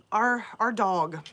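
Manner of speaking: usual speaking voice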